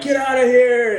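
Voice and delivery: cops yelling. Retold or imitated. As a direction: yell at the traffic to clear off. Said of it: "they're like".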